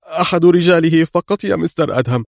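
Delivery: high-pitched, anxious answer; crying out. A narrator's reading. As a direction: blurt it out under threat. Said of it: "هتف جولييت". (هتاف متوتر)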